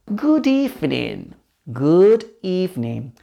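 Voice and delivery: "Good evening" drawn out slowly for learners; slow, careful "Good evening"; slow model. Shown as a demonstration. Teacher models phrase slowly, then quicker.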